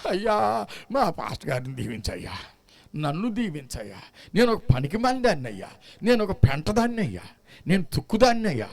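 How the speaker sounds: softly voiced self-pitying old woman, whimpering; a hint of a whiny elderly prayer; mock old woman praying. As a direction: lightly imitate an old woman groveling in prayer. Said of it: "ఓ పది మంది ముసలోళ్ళు వస్తారు".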